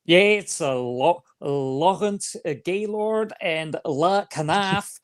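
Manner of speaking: put-on slow, thick southern-accented French. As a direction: imitate a thick southern drawl mangling French names. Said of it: "with a really thick accent".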